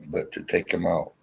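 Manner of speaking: hiccup cuts the word. Real, not acted. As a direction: speak through hiccups. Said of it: "goddamn hiccups".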